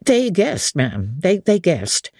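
a respectful butler recounting the disaster; old butler's voice. Acted.

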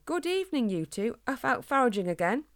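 high, lilting hello; friendly runner's greeting. Acted. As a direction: greet friends met by chance.

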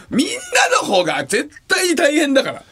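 高く叫びながら笑い泣き; 笑いで声が裏返るほど訴える; 泣き笑いの叫び. Real, not acted. 泣き笑いで叫ぶ. (相方に泣き笑いで叫び続ける)

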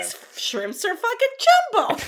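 high-pitched quote of a catchphrase; Jennifer. Retold or imitated. Repeating a reality star's line for laughs, high imitation. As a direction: blurt it high, imitating her, punching the last word. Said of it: "Jennifer says it".

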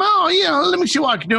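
high, noncommittal mock promise; polite brush-off. Putting off a client with a shallow request, high-pitched brush-off. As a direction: fob them off in a high, easy voice.

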